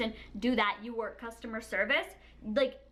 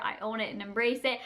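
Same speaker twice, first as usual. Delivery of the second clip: normal talking voice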